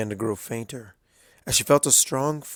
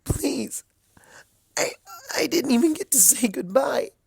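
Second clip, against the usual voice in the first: high whispered sob-plea, then higher; crying plea; 14 semitones higher than usual. A narrator's reading. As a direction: cry as you cling to her.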